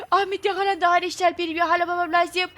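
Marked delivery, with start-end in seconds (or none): none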